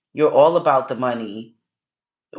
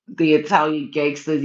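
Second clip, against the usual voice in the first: ordinary delivery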